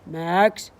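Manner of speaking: plain reading voice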